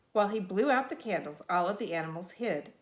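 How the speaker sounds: plain reading voice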